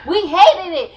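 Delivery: loud burst of laughter into the words; laughing out loud. Real, not acted. Reacting to friend's [name] comment, loud real laugh. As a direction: crack up, then push the words out laughing.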